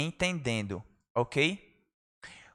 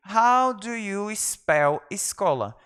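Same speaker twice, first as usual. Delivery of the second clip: slow, careful classroom English; slow model phrase; 3 semitones higher, much slower than usual. Shown as a demonstration. Teacher showing students how to ask, slowly. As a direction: say each word slowly and clearly. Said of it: "teacher models phrase slowly".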